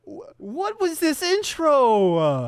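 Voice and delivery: high, loud, drawn-out bewilderment; stammer, then stretch it out high and loud; astonished outburst. Real, not acted. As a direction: wail it in stunned disbelief. Still astonished by the intro.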